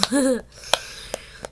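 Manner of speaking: real amused laugh; spontaneous laugh at a funny phrase. Real, not acted. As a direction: burst out laughing briefly. Amused by the man-child description.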